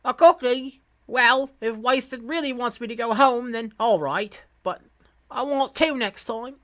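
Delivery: put-on high-pitched British kid, bargaining; British accent. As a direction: put on an eager English child's voice. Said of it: "like, a British Englishman accent".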